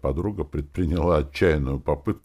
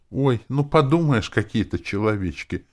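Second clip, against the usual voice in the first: high, dismissive scoff; contemptuous brush-off; disdainful; 8 semitones higher, 5 dB louder than usual. A narrator's reading. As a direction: scoff in a raised pitch. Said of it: "скривилась Роза".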